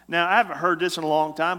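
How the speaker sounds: ordinary talk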